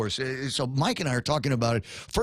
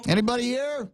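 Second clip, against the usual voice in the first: mock call; 10 semitones higher than usual. Continues the governor impression.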